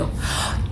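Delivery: a sudden startled gasp before the scream; sharp gasp. Shown as a demonstration. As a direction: gasp as if ambushed. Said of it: "I can demonstrate it for you".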